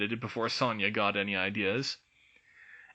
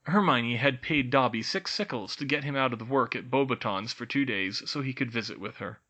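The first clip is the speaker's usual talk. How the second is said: ordinary delivery, nothing marked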